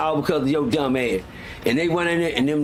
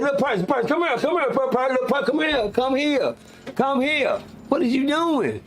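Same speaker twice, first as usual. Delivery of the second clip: retold coaxing, voiced much higher; lightly voiced old-timer calling him over; a higher, mild imitation of an old head coaxing; 10 semitones higher than usual.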